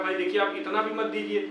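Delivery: normal talking voice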